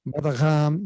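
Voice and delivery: French-accented throaty r. Shown as a demonstration. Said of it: "in French".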